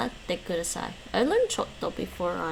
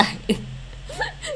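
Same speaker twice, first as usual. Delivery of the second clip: squeaky laugh of frustration; high burst of laughter. Real, not acted. Laughs at own outburst.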